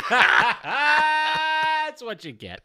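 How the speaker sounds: loud, high, drawn-out cackling taunt; gloating through a big fake laugh; mocking laughter. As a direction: laugh in their face. Acting out laughing at crier.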